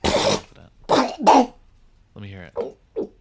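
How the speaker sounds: loud honk. Acted. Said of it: "blow your nose real loud".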